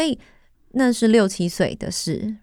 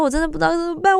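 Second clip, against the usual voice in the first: 我真的不知道怎么办 pitched much higher, pleading, stress on 办; panicked plea; 10 semitones higher, faster than usual. Retold or imitated. Telling her mom on the phone she doesn't know what to do, high and panicked. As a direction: cry out 我真的不知道怎么办 like a scared child.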